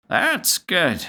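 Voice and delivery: slow, high granny asking; a confused old gran, drawn out; high old grandmother voice. Acted. Grandmother asking Zack about the noise, slow high old-lady voice.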